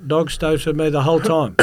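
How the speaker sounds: echoing the guard. Retold or imitated. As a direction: give the question back as a firm answer.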